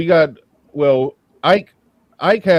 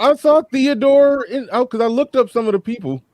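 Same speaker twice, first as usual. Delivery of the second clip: excited; 11 semitones higher than usual. Real, not acted. Sudden realization, excited recall.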